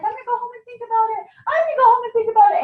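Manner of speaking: high, fast, whimpering imitation; mock whiny guest. Consultant mimicking a guest who stalls, high and whiny. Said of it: "that person's like".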